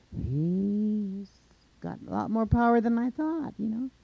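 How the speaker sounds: high, loud musing voice; high-voiced impressed monster; surprised monster. A narrator's reading. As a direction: marvel aloud, pitched well up. Monster surprised by Coyote's power.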